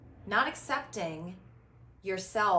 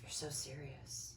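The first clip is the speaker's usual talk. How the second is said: lower, softer, flat mock reproach; mock serious; 7 semitones lower, 14 dB quieter than usual. Host mimics people calling her serious, low and soft. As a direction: drop the voice to mimic a dour critic.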